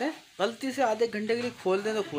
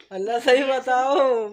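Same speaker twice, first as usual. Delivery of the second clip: pleading; 6 semitones higher, 8 dB louder, much slower than usual. Real, not acted. Husband begging wife for truth.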